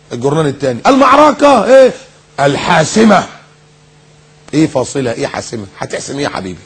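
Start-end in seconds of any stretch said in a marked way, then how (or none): 0.9-3.2 high, drawn-out bombastic headline; mock headline announcer. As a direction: proclaim it with mock grandeur. Mock second newspaper headline. (إعلان مانشيت بفخامة ساخرة)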